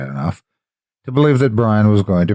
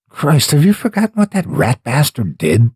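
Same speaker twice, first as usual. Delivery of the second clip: higher, heated outburst at the ex; angry retort; 8 semitones higher than usual. Acted. Carla arguing with Jenny about her ex, heated and high. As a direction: spit out the insult, indignant. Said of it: "Carla argued".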